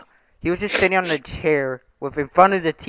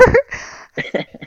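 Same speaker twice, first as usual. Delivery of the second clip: high unvoiced laugh. Real, not acted. Laughing at slap story.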